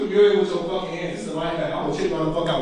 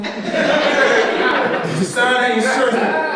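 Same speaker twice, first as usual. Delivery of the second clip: mock coach question; 5 semitones higher than usual.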